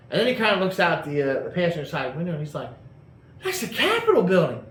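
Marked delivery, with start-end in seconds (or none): 3.4-4.6 retold panic, pitched way up and louder; the friend wakes up and realises they're in D C, panicking; freaking out. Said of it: "he starts freaking out".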